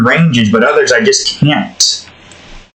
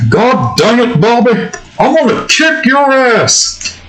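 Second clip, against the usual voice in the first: exasperated, higher-pitched cartoon dad threat; Hank Hill; 7 semitones higher than usual. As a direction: scold your son in a put-on cartoon voice. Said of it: "I can get a good Hank Hill".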